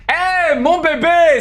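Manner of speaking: high, slow, drawn-out "mon bébé"; high affectionate call. Real, not acted. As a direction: sing it out to a friend. Greeting a subscriber affectionately.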